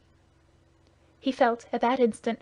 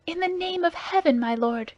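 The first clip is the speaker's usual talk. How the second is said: pleading cry, higher, rising in alarm; 8 semitones higher than usual. A narrator's reading. Madame Bonacieux cries out as the men draw swords. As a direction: throw the words out as you rush between the swords. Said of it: "cried Madame Bonacieux".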